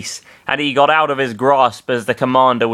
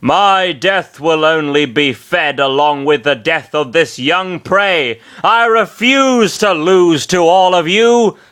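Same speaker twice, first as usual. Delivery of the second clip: raised, defiant villain threat; 5 semitones higher, 4 dB louder than usual. Acted. Commander Bernard threatens the heroes, defiant villain voice. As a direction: sneer it out as the cornered commander.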